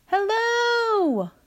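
high, drawn-out call; long sustained high-pitched hail; yelling out. A narrator's reading. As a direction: holler down to someone far below. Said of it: "Walter yelled out".

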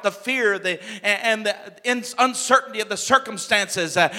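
usual speaking voice

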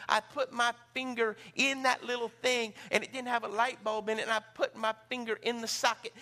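ordinary talk

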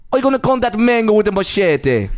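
botched Jamaican accent that slips toward Irish; attempted Jamaican accent. Shown as a demonstration. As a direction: try a Jamaican accent and miss. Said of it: "I was gonna do an accent".

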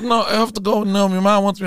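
high, loud mimicked excuse; imitated insistent girl. Girl tells him her mom wants her, high insistent mimicry. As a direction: raise the voice for her refusal.

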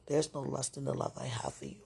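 ordinary talk, nothing marked

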